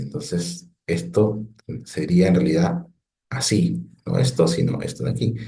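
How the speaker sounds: ordinary delivery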